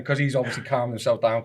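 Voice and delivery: ordinary talk, nothing marked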